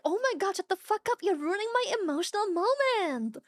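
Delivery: hammed-up pleading annoyance; acted exasperation. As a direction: complain like someone whose moment is being ruined, half laughing. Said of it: "I'm like".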